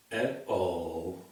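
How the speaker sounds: usual speaking voice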